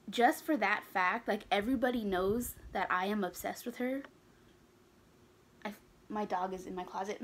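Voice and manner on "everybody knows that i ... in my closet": ordinary talk, nothing marked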